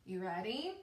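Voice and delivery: ordinary talk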